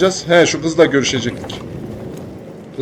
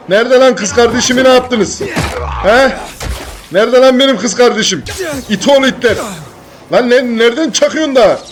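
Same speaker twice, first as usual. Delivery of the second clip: angry yelling; 6 semitones higher than usual. Acted. Yelling at gang while fighting.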